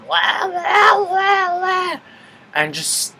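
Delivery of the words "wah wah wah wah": high, whiny mock wah-wah noise; high-pitched mocking wail; loud drunken-girls wailing. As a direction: imitate rowdy girls as a shrill string of wahs. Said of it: "a group of girls come in loud".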